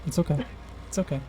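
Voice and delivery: soft, calm reassurance; quiet soothing; reassuring. Real, not acted. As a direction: settle them down gently.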